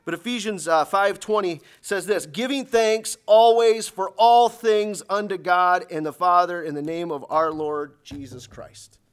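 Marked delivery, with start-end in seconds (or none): 2.2-9.0 slow, loud, emphatic verse reading; scripture proclamation. Real, not acted. Preacher reading Ephesians to congregation, emphatic proclamation. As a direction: declare the verse slowly, falling away at the end.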